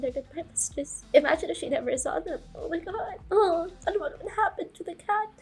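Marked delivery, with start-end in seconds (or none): 2.5-5.3 tearful worry. Real, not acted. Sobbing worry over show.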